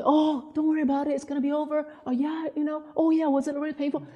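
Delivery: lightly acted fussing reassurance; comforting hospital staff. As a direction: imitate doctors and nurses soothing a patient. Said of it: "tried to comfort her and all that".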